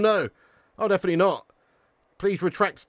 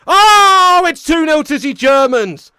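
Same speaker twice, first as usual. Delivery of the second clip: much higher and louder, slow groaning announcement; excited goal reaction; 11 semitones higher, 14 dB louder, slower than usual. Real, not acted. Streamer reacting live to Germany's second goal, loud and excited. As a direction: cry out as the goal goes in.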